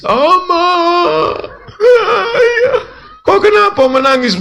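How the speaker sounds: high, tearful daughter's cry. Retold or imitated. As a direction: wail it like a weeping young bride. Daughter crying to father.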